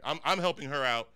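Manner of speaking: ordinary delivery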